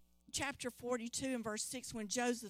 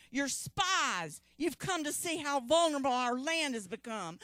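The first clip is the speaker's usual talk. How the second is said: louder and higher, stern ruler accusing; harsh accusation; 4 semitones higher, 8 dB louder than usual. Acted. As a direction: accuse the strangers sharply. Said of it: "So he said to them".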